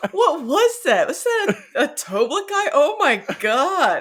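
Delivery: high, loud comic bewilderment, laughter in it; mock astonishment. Voicing companions just hit by someone, mock shock. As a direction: exclaim in hammed-up disbelief, drawing out the end.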